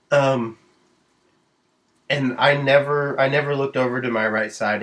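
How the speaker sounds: ordinary delivery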